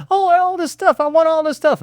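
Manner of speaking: put-on high greedy toddler voice; whiny toddler. Parent retelling a toddler in a store, high whiny kid voice.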